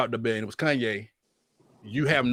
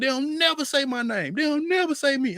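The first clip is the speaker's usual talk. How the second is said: retold getting mad; 10 semitones higher than usual. Complaining that articles never name him, high indignant protest. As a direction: pitch it up, protesting being left out. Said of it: "I be getting mad".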